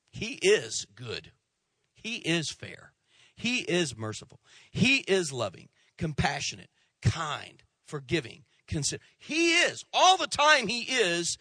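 slow, hammered preaching lines; emphatic preaching. Acted. Preacher insisting on God's goodness, slow and building to high. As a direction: build each "he is" like a drumbeat.

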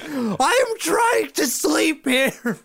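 high, drawn-out mad holler; re-enacted crazed high yell; maniac yelling. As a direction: scream it slowly like a sleepless maniac. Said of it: "running at them like a maniac".

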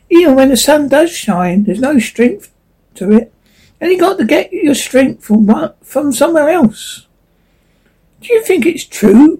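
a worried leaf wondering aloud. Acted. Leaves talking, higher voice.

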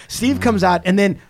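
ordinary talk, nothing marked

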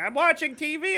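put-on high, loud kid's complaint; whiny kid. Dad imitates his son's high, loud whiny reply about TV. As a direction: whine back like a distracted boy. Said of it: "And he's like".

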